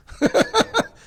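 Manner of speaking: loud, high burst of laughter. Real, not acted. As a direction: laugh out at yourself. Laughs at his own crying.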